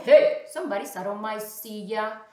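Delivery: loud, indignant papa bear. Acted. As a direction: boom it out as the big father bear. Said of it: "also papa said".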